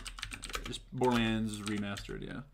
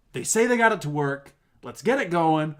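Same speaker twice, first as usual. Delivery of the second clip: loud, raised mock rallying call; imitated stage announcer; 5 semitones higher, 7 dB louder than usual. Presenter telling the crowd it works now, imitated loud rallying. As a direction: project it higher to a big room.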